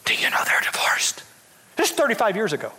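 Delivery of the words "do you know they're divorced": hushed gossip whisper; whispered tattling about the sinners. Retold or imitated. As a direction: lean in and whisper it like church gossip. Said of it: "they'd walk up".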